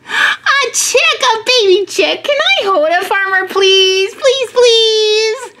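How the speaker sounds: put-on very high, eager little-kid voice; squealing with delight, begging; excited begging. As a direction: plead like a thrilled child. Child begs to hold chick.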